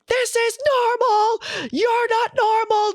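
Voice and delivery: very high, strained mock-hysterical rant; retold meltdown. Re-enacting losing it at a boyfriend after two years, high-pitched meltdown. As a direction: squeal it out as if losing it completely. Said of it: "I lost my shit".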